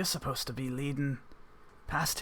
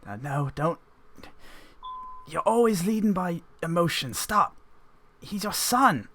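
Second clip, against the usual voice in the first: higher mild plea from the mother; mother pleading; 5 semitones higher than usual. Acted. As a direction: beg him, rising, to spare her son. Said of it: "your mother pleading".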